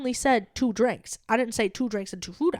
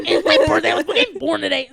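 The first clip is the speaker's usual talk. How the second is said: mock loud demand; 4 semitones higher, 5 dB louder than usual. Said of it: "I don't wanna be like".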